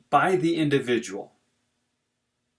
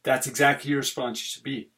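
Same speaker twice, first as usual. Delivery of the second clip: usual speaking voice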